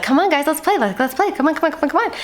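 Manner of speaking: high, bouncy begging to play; eager puppy voice. Acted. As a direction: beg like an excited puppy.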